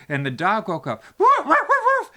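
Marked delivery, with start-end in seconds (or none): 1.2-2.1 very high, loud woof woof; dog barking. Acted. Teller barks as the dog waking up, high woofs. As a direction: yap like a small dog.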